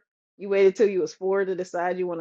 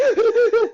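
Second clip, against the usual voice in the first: high, loud imitated bawling; mock child's crying; 5 dB louder than usual. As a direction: fake a little boy bursting into tears. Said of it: "he busts out crying and he's like".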